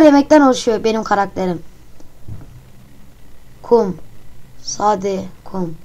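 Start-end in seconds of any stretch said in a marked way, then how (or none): none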